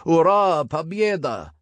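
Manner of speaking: high charging war cry; roared war cry. Acted. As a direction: voice the enemy horde's cry. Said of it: "roared closer and closer".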